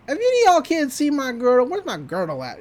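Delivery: imitated older woman's voice, starting high and loud; high, punchy opening that drops away; Grunkle Stan. Uncle with a new voice asks the kids about his girdle, mock lady voice. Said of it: "he's got a new voice".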